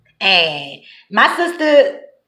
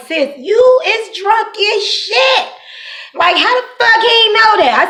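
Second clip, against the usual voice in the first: laughing disbelief; 12 semitones higher than usual. Retold or imitated.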